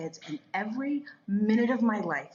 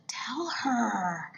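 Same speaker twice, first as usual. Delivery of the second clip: lightly voiced divine nudge, whispery; a hint of an insistent whisper from God; God prompting; 2 semitones higher than usual. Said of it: "And God is like".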